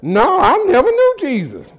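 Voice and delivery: high-pitched comic feigned ignorance; mock denial. Peter at gunpoint denying Jesus, mock denial. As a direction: play dumb, high and incredulous.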